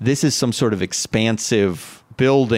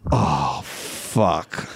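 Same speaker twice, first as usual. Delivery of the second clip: deflated groan; 8 semitones lower, 4 dB quieter than usual. Retold or imitated. Alone after group leaves.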